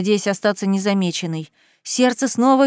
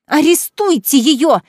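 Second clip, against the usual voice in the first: loud, slow, higher shout; furious demand; 5 semitones higher, 7 dB louder, slower than usual. Acted. Cousin demanding the officer arrest Kaitlyn, loud furious shout. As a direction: demand it at full voice.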